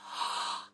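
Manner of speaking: sharp airy intake of breath; a stunned breathless gasp; shocked gasp. Retold or imitated. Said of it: "And I was like".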